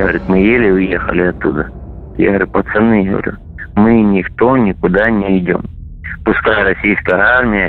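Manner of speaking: ordinary talk, nothing marked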